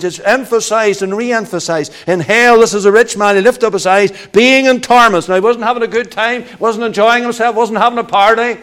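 loud, driving hellfire preaching; preaching at full force. Acted. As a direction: pound the pulpit with each phrase.

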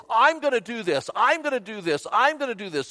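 high, emphatic, stubborn repetition; mock defiance, pitched high; self-willed insistence. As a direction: insist on having your own way. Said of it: "Self-will".

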